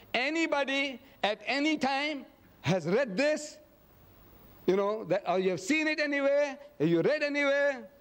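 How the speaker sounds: imitating a chairman polling the room; chairman addressing a crowd. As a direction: call the question out high to a big hall. Chairman questioning six thousand people.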